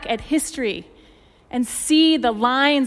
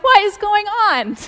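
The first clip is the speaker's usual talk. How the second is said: much higher and louder, indignant disbelief; shocked outrage; 10 semitones higher, 5 dB louder than usual. Retold or imitated. Indignant cry as another car steals her parking spot. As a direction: yelp it out at the rude driver. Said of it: "I'm like".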